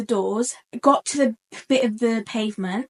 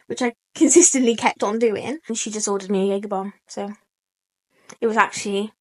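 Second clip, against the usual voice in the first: normal talking voice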